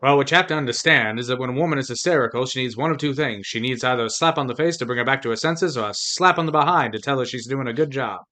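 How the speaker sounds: hammed-up old-Hollywood transatlantic accent, neither English nor American; mock transatlantic accent. Joking to his co-host in a mock transatlantic accent. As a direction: play a smug fifties leading man in a transatlantic accent. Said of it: "That's why I'm talking like this".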